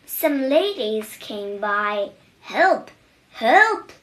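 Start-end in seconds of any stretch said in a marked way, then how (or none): 2.4-3.9 loud, high, rising cries; cry for help. A narrator's reading. Old mouse, pants down, shouting for help loudly. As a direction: call out for help in a panic. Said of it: "cried the old mouse".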